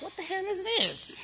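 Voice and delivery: high startled shriek, half-voiced; shocked high question. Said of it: "and I was like".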